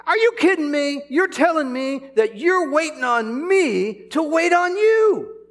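high, loud disbelief, half laughing; incredulous outburst. Retold or imitated. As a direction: burst out like you can't believe it. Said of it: "I said".

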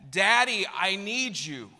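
imitated toddler calling for daddy, high-pitched; high, drawn-out mock kid's call; child calling out. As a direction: call down the hall like a small child. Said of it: "I heard from the other room".

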